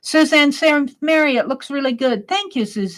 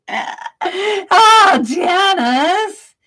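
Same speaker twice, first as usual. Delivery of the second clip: excited greeting; 8 semitones higher, 5 dB louder, much slower than usual. Real, not acted. Greeting a chat member arriving.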